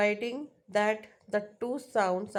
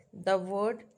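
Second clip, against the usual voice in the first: ordinary delivery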